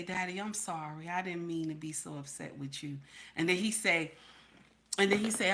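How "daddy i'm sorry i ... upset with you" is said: hushed, lower apology to Daddy; wife apologizing sweetly to her husband; soft apology. Retold or imitated. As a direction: coo the apology gently.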